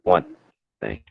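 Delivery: choppy cutting-out audio; mic breaking up. Real, not acted. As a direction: voice dropping out on a bad line. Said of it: "Breaking up, brother".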